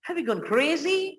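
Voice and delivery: disbelieving shocked question; shocked reply. Retold or imitated. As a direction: react as if they lost their mind. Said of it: "सामने वाला सुन के जरूर कहेगा".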